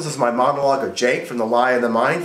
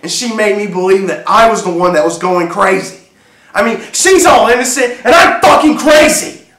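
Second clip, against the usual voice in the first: raised voice building into a high shout; indignant outburst; 7 semitones higher, 6 dB louder than usual. Acted. Husband protesting that she made him seem crazy, building to a shout. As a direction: start firm, then explode in outrage. Acted outrage building again.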